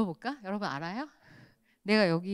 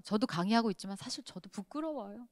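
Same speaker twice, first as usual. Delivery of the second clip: ordinary delivery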